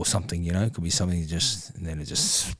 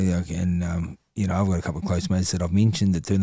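usual speaking voice

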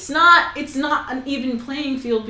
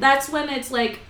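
ordinary delivery

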